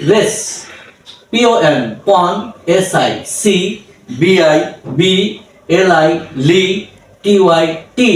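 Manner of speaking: slow letter-by-letter syllable breakdown; syllables spelled out. Shown as a demonstration. Tutor to learners, spelling syllables slowly. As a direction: spell each syllable, then say it. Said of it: "এটাকে আমরা ভেঙেছি".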